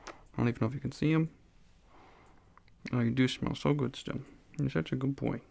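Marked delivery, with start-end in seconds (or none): 2.9-5.4 cooing to the cat. Real, not acted. Cuddling cat on lap.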